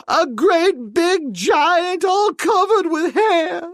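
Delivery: very high wailing, sob in the voice; terrified sobbing wail; high, slow sobbing maid. A narrator's reading. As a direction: cry it out in horror. Maid's voice held throughout.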